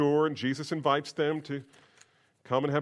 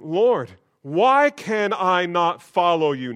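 Peter's plea; 4 semitones higher, 9 dB louder, slower than usual. Acted. Peter voiced, pleading.

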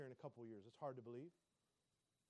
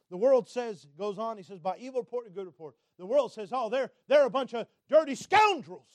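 loud preacher, building to an outburst; full-force preaching; 5 semitones higher, 14 dB louder than usual. Acted. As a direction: preach it loud, hammering the key words.

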